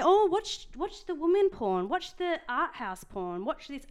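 high, loud, mock-eager urging that fades as it goes; a lightly voiced, mock-excited friend; mock gushing advice. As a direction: parody a pushy friend's recommendations. Mocking friends' porn advice.

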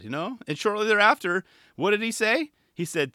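ordinary talk, nothing marked

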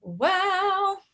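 high-pitched, hesitant "well"; sheepish stalling. Real, not acted. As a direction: stall on a high, awkward note. Sheepish stalling when asked her feelings.

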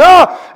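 high loud panicked scream; a scared kid's shriek; child's scream. Acted. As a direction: let out a short terrified ah. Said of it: "he's screaming".

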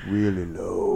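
low, deep, put-on voice. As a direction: drop the voice down low. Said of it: "What if we talk really low?".

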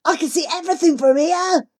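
loud, high delight; thrilled from up high; excited boy. A narrator's reading. Jivan shouting from atop the elephant, loud and thrilled. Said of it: "excitement dancing in his eyes".

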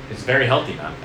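ordinary talk

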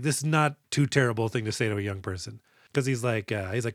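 ordinary talk, nothing marked

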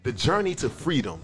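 slow, low, solemn recital; dramatic reading. Acted. Host reading August's post to friends as a slow, solemn recital. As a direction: draw each phrase out low and soft, with weighty pauses.

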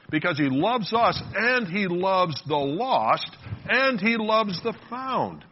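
high, slow, building declaration; emphatic preaching. Real, not acted. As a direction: hammer each clause with rising conviction. Preacher building to his main point.

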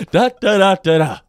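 loud, high mock-rant syllables; mock ranting babble. Carrying on a film star's tantrum, loud mock rant. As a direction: shout nonsense syllables as a pretend tantrum.

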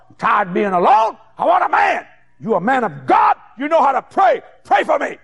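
put-on high, loud old woman begging; pleading shout. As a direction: shout out the plea for a man. Old woman begging preacher to pray.

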